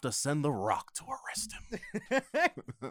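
slow mock news reporter, breaking into laughter; drawn-out, exaggerated reporter caricature. Co-host finishing the comic's routine, slow mock-reporter voice with laughter. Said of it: "exaggerated news reporter".

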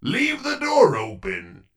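ordinary talk, nothing marked

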